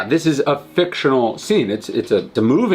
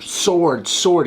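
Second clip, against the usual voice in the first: higher-pitched mock call for a weapon; mild retold shout; 7 semitones higher than usual. Commodus calling to his Praetorian guards, mildly imitated shout. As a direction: imitate a shout without much added volume. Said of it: "so Commodus shouts, he says".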